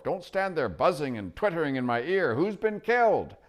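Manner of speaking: put-on high, loud cranky old dwarf; deaf old dwarf. Dwarf cupping his ear at the owl, loud and cranky. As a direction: bark it like a deaf old man.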